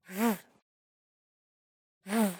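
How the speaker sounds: "V." as a soft buzzed vvv, not the letter name; isolated V sound. Shown as a demonstration. Teacher isolates the end sound, bare V buzz. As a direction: buzz a short "V." sound alone. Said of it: "final V sound at the end".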